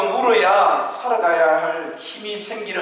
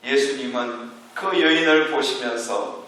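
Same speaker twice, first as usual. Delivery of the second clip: ordinary talk, nothing marked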